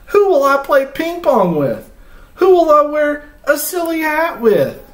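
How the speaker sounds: high, pleading elephant voice, rising to a wail; pleading Gerald. Acted. Gerald begs Piggy not to go, high pleading voice. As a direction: beg your friend not to leave you, pitched high.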